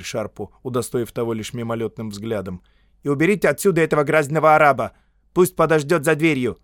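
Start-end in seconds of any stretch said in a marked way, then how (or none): 3.0-6.6 fast, loud, higher order; раздражённый писарь. A narrator's reading. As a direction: order him out impatiently. (быстрый громкий раздражённый приказ)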